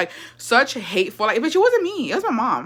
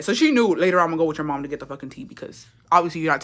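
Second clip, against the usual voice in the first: ordinary talk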